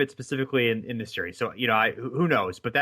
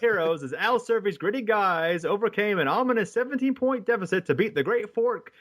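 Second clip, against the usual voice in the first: higher vintage radio announcer read; old-timey announcer voice; 8 semitones higher than usual. Acted. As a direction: call it like an old newsreel. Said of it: "read part of it in my old-timey, um, uh, announcer's voice".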